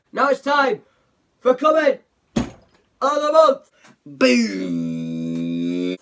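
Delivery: segment announcer. Pretend. YouTuber introducing comment of the month, big announcer shout. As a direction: belt out the segment title like a hype announcer.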